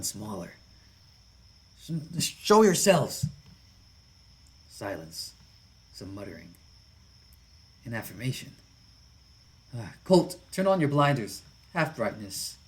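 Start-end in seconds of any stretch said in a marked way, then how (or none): 1.8-3.3 loud, high call into the dark; nervous challenge into the night; startled shout. Acted. Calling out into the dark. 9.8-12.6 lightly voiced hearty biker otter, louder; easygoing big lug giving an order; a hint of a burly biker calling to his buddy; big friendly biker. Biker otter Jesse speaks.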